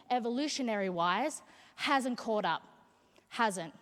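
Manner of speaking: ordinary talk, nothing marked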